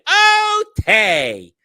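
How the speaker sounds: put-on high, loud squeaky kid voice; Buckwheat. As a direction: squeal it like a cartoon child. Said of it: "Buckwheat on the Little Rascals went".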